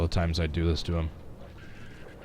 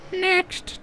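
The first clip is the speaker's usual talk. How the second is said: NEXT cracking on purpose. Shown as a demonstration. Streamer playing with a farewell, deliberate voice crack. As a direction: let the voice break sharply on NEXT. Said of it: "purposefully get my voice to crack".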